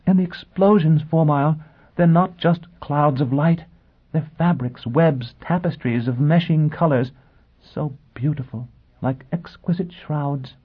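high, dreamy aristocratic lady; entranced noblewoman. A narrator's reading. Noblewoman describing the bombing to her guest, entranced.

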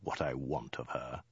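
low, soft menace; understated, sinister question; menacing hiss. A narrator's reading. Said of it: "he hissed".

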